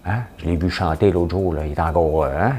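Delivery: ordinary delivery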